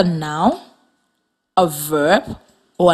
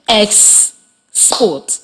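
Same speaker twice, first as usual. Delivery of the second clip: "Export" broken into two beats with a clear pause; split into syllables. Shown as a demonstration. Teacher models syllables for listening students, "Export" split with pause. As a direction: sound out "Export" one syllable at a time. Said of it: "while I clap the syllables".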